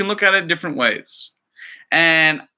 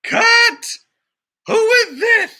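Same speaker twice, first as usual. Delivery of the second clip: much higher, louder startled yell; director shouting; 5 dB louder, much slower than usual. Acted. The director demanding who interrupted, shouting high. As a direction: stop the take in outraged surprise.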